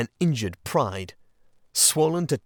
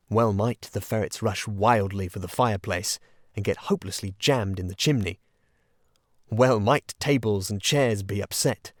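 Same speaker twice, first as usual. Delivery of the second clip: usual speaking voice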